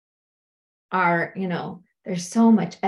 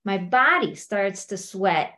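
usual speaking voice

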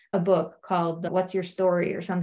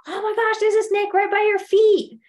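high, loud mock alarm; acted panic, pitched way up; petrified; 11 semitones higher, 5 dB louder than usual. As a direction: cry out a hammed-up warning of danger. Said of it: "She's petrified".

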